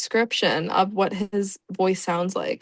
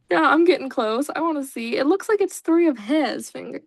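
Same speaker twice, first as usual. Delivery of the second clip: ordinary talk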